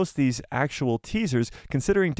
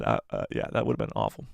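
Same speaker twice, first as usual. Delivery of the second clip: much lower, gruff sniper growl, lightly done; Crosshair voice; 5 dB quieter than usual. Shown as a demonstration. Streamer jokes how his Crosshair would sound, in low voice. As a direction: drop low like a clone sniper. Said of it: "My Crosshair would have been so bad".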